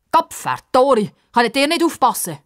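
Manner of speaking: put-on loud, high angry scolding old man; cursing. A narrator's reading. Old man scolds the children, loud curse. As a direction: curse at the kids. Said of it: "flucht ein alter Mann".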